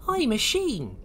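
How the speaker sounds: put-on high-pitched young sea creature; Oisin. Oisin meets the naughty Lloyds, in a high voice. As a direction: introduce yourself in a high character voice. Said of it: "he said".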